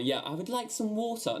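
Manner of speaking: high, over-proper British "water"; exaggerated British accent, prim and high; mock British accent. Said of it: "We don't talk like this".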